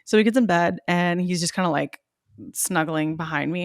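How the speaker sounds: ordinary talk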